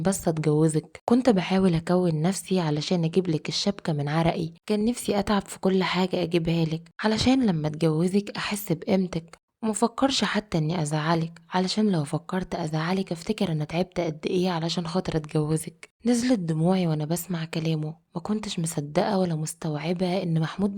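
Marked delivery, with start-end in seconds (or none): none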